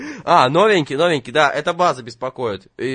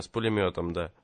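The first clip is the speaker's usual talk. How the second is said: ordinary delivery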